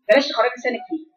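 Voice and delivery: ordinary talk, nothing marked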